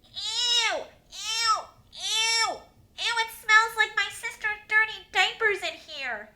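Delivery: put-on high, loud grossed-out little boy; disgusted shrieking. Boy on his mat complaining of a smell, shrieking. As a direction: wail each ew, then keep complaining up high. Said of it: "I hear another shriek".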